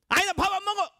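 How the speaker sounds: put-on high, loud childish refusal; King Sejong tantrum. King refusing meatless meal, loud high tantrum. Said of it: "세종대왕도 그때 당시 그랬을 거란".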